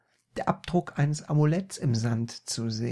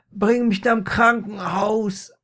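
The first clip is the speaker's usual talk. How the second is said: high, loud hammed-up plea; crying out; 8 semitones higher, 7 dB louder than usual. A narrator's reading. Injured Peter demands hospital, loud high cry. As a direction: wail for help at full voice. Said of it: "rief er".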